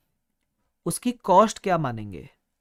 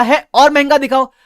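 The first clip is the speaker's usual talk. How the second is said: loud, high demand for more; 8 semitones higher, 12 dB louder than usual. Retold or imitated. Customer demanding a costlier laptop, loud. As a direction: push him for something pricier.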